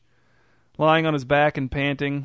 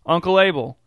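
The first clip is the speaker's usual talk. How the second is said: higher, louder call to an uncle; insistent raised-voice call; 4 semitones higher, 5 dB louder than usual. A narrator's reading. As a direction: call out to rouse him, raised voice.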